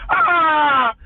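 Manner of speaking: high loud mock yelp of alarm; hammed-up alarmed "Ah!"; mock cry. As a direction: yelp as if in fake panic.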